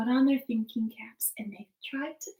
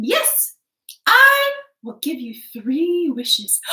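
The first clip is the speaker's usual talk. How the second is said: grand fairy offer; 6 semitones higher, 11 dB louder, slower than usual. Acted. Fairy grants wishes.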